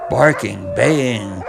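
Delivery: plain reading voice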